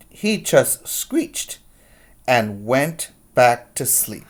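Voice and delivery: put-on lively read-aloud to kids; picture-book reading voice; story narration. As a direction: read it like storytime, big and expressive.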